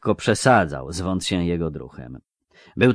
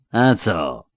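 harked, rasped out; 3 dB louder than usual. A narrator's reading. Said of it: "harknął jeden z tych".

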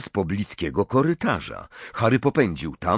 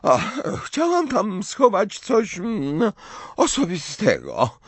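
mumbled; 12 semitones higher, slower than usual. Acted. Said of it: "wymamrotała".

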